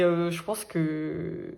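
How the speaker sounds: ordinary talk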